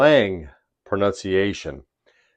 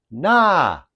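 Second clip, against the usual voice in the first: "Knowledge" with a high, drawn-out "NAH"; first-syllable emphasis; 8 semitones higher, 3 dB louder than usual. Shown as a demonstration. Teacher demonstrates emphasis on first syllable, "Knowledge" as high "NAAH-lidge". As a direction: stretch and lift the start of "Knowledge", as "NAH-lidge". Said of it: "emphasis, first syllable".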